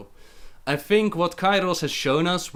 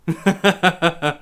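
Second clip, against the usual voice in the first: laugh; 3 semitones higher, 4 dB louder than usual. Real, not acted. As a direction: burst of ha-ha laughter. Mocks butchered game lore.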